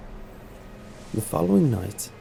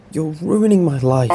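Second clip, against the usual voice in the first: loud, high sleepy accusation; sleep talking; 7 semitones higher, 8 dB louder than usual. Retold or imitated. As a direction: voice the wife talking in her sleep, louder. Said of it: "sleep talking".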